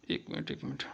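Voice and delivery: near-whispered, half to oneself; barely audible stalling; quiet aside. Real, not acted. Teacher checking the question number, quiet aside.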